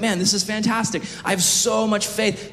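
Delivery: high-pitched acted amazement; excited, wonder-struck disciple; thrilled. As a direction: gush with delight, voice lifted high. Acted thrilled Peter walking on water.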